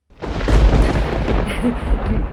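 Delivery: loud unvoiced burst of laughter; breathy laugh. Real, not acted. Laughing at the irony of the husband's death, breathy burst.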